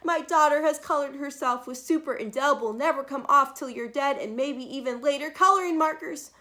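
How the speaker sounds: high, loud panicked cries; frantic mother. Acted. Mother phoning the doctor in a frantic panic. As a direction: plead for help on the phone. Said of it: "She called the doctor and said".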